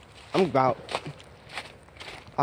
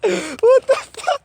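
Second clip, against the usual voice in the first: shocked; much slower than usual. Real, not acted. Shocked by loud echo.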